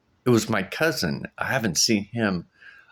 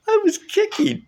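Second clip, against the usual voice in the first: much higher and louder through real laughter; real laugh breaking the line; laughing reveal; 6 dB louder than usual. Real, not acted. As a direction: burst out laughing on it. Laughing at how she woke.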